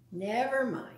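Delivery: plain reading voice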